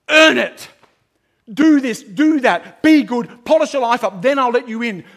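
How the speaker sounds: loud, pointing commands; mock stern demands. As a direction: bark orders like a taskmaster. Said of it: "he doesn't point at you and say".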